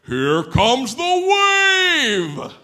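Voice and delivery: booming loudspeaker announcement; terrifying announcer shout. Retold or imitated. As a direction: boom it out like a scary PA voice. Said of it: "this terrifying voice that says".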